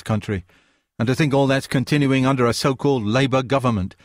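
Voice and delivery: lightly hammed-up posh English accent; a hint of a posh English accent, mocking. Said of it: "adopted a mock posh accent".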